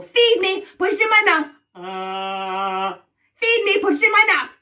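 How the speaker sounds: high, loud, greedy witch; demanding witch. Pretend. Witch orders the players to feed her, demanding. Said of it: "I'm going to order you around".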